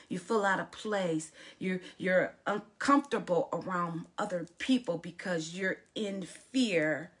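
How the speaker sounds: normal talking voice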